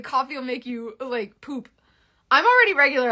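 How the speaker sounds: normal talking voice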